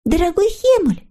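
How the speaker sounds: put-on very high, sweet girlish character voice; eager little girl figure. A narrator's reading. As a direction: agree gladly in a high little voice. Snork Maiden comforts Hemulen. (тоненький девичий голосок)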